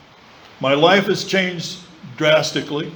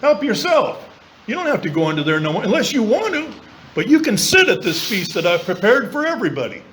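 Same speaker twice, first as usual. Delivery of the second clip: lightly voiced, higher, gentle God; a hint of a welcoming God; voicing God; 6 semitones higher than usual. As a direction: offer the seat kindly, a bit higher. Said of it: "God says".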